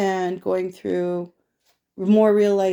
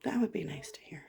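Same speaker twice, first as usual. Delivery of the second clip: soft wistful whisper, much lower; whispered aside; 4 semitones lower, 11 dB quieter than usual. Real, not acted. [name] wishing for kind words, whispered aside. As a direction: breathe it out quietly to yourself.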